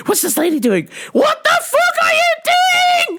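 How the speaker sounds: rising from mutter to a high furious yell; mom rage building to shout. Retold or imitated. Mom escalating at the braking driver, climbing into a high shout. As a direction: let it climb into a scream at the driver.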